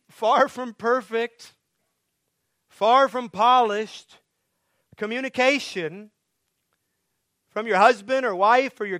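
high, loud, pleading preacher's cry; full-force preaching. Acted. Pastor urging the congregation to listen to sufferers, impassioned preaching. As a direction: press the question home to the church.

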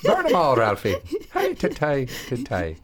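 sing-song fake Irish chant; mock Irish leprechaun. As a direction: repeat the leprechaun bit, hammed up. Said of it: "You said it, like, three times".

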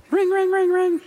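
very high, loud ringing-phone imitation; imitated phone ring. Pastor mimics a ringing phone after his cell tower joke, high-pitched imitation. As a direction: trill it out like a ringing telephone.

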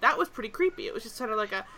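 normal talking voice

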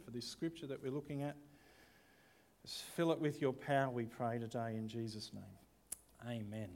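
soft, hushed prayer voice. Acted. Pastor praying over the reading, soft and reverent. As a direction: pray quietly and earnestly.

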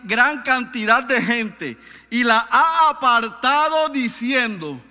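quote read aloud. Retold or imitated.

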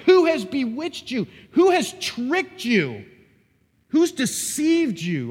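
slow, high, loud accusing questions; upset. Acted. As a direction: drag out each question in outrage. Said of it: "you know, upset".

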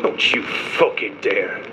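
low, slow, controlled anger; seething, drawn-out threat; menacing warning. Acted. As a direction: warn them off slowly, holding the fury in. Prisoner warns interrogator off insulting friend.

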